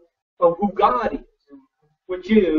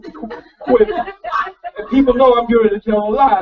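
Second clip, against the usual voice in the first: normal talking voice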